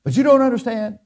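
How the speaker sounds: high pleading employee whine; mock worker pleading with the boss; whiny excuse. Mimics employee whining excuses.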